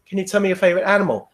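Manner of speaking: a worn-out examiner on autopilot, fast; bored, rattled-off question. Retold or imitated. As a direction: rattle it off, numb from repetition. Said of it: "And the next person".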